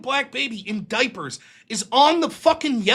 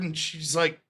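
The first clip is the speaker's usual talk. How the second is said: usual speaking voice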